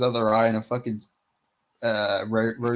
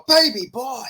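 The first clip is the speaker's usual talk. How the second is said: high, loud put-on yelp; hammed-up shout of a catchphrase; mock catchphrase cry; 12 semitones higher, 6 dB louder than usual. Imitating Bisping's catchphrase.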